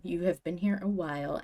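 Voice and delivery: normal talking voice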